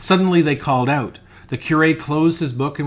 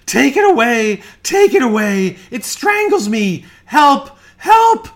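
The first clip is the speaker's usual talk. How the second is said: high, loud screams of terror; terrified cry; 12 semitones higher, 10 dB louder than usual. A narrator's reading. Dying man begging friends to save him from strangling, terrified screaming. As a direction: cry out in mortal dread, climbing to a shriek. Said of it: "he cried, waving his arms as if a prey to the most awful terror".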